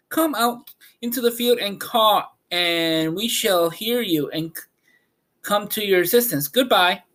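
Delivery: a tiny regal mouse speaking up; tiny mouse queen. Acted. Queen of Mice bids Dorothy farewell, high squeaky.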